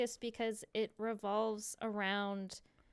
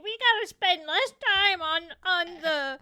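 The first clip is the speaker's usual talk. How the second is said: higher, louder mock whine, laughing through the end; mocking Nick; 7 semitones higher, 9 dB louder than usual. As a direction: parody a bossy friend, pitched up. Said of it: "Nick was all like".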